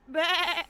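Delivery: loud, high mock sheep bleat. Speed teasing his master with a loud, high bleat. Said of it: "will make me cry".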